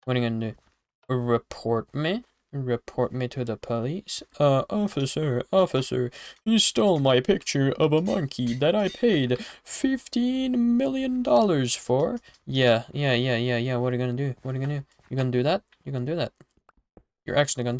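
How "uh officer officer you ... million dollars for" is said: mock whiny complainer. Mock plea to the police.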